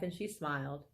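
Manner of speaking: plain reading voice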